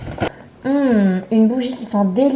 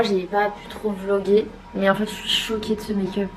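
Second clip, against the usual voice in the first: ordinary talk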